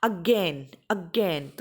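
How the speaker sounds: again with a long, falling GAIN; stress on second syllable. Shown as a demonstration. Teacher giving another example word, stressed second syllable. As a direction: put the weight on the "gain" of again. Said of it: "second जो syllable है उसके ऊपर".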